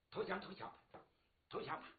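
very soft, murmured concession; resigned giving in. Acted. Father reluctantly yielding to his daughter, resigned. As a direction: concede it under the breath.

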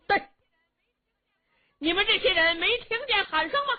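high, loud, fast shouted challenge from horseback. A narrator's reading. General on horseback shouting at the men playing chess, loud shout. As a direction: bellow it down at the gate from the saddle. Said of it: "宇文成都在马上高声喊嚷".

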